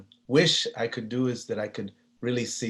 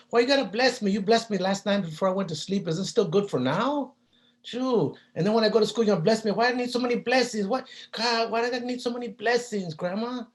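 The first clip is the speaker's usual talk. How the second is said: put-on much higher, complaining kid voice; whiny child; 11 semitones higher than usual. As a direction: whine like a sleepy kid woken too early. Child whining to grandma.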